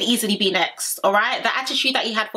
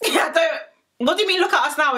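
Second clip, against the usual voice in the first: incredulous; 8 semitones higher than usual. Real, not acted. Incredulous mocking reaction.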